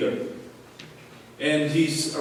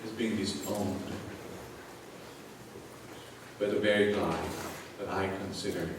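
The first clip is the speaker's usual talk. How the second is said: quiet, wounded voice of a betrayed friend; hurt friend; 4 semitones lower, 6 dB quieter than usual. Acted. Jesus quietly telling Peter the betrayal pains him, hushed and hurt. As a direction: speak softly, as if the hurt is still fresh.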